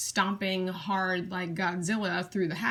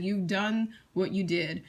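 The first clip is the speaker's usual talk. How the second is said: ordinary talk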